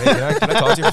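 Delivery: high, loud mock swaggering chef; dick-first talking. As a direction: ham up a crude, pushy come-on. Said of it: "yeah, dick first talking".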